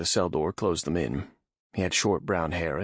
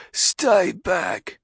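louder gruff guard shout; 13 semitones higher, 7 dB louder than usual. Acted. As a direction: yell it through the door. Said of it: "a gruff voice said".

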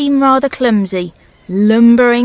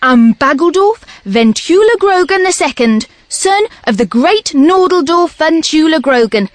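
loud, raised, pompous troll voice; grand troll announcement; 7 semitones higher, 5 dB louder than usual. A narrator's reading. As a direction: proclaim the titles loudly like a herald. Troll grandly introduces himself by titles.

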